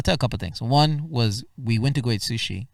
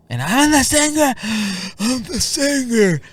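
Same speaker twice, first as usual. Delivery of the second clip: much higher, louder, with a gasp and a mock sob; breathless panic; 13 semitones higher, 8 dB louder than usual. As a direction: gasp it out as if about to cry. Arrives breathless, announces himself.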